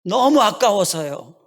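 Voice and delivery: put-on slow, tearful child voice, stress on 너무; a little child begging through tears, 너무 leaned on; slow tearful child, 너무 stretched out; crying child. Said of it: "그러면서 우는 거예요".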